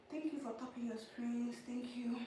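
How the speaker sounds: breathless whispered thank you. Real, not acted. Thanking viewers for tapping screens, whispered.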